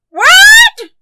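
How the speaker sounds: very high, loud shriek of surprise; granny's startled high yelp; shocked cry. A narrator's reading. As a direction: shriek it in utter disbelief. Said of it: "cried Granny".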